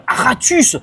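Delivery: loud, high cry of a title; excited exclamation. Real, not acted. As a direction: belt out the title in amazement. Lit un titre avec surprise. (titre lu en s'exclamant)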